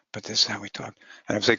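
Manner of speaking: ordinary talk, nothing marked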